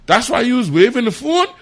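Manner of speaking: the penny drops, loudly; astonished realisation. Retold or imitated. Sudden realisation, disbelief.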